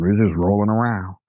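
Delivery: ordinary talk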